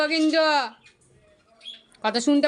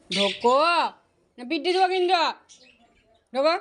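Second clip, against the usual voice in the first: mock scolding; 2 semitones higher, 5 dB louder than usual.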